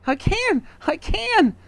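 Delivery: very high repeated yelp; wife crying out. A narrator's reading. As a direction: yelp it twice in distress. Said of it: "cried Miss Twit".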